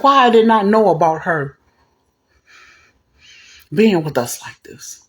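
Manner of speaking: imitating a man going off, slowing on the last words; retold angry outburst. Bush learns he was never told about her, retold angry outburst. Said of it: "He was mad. Going off".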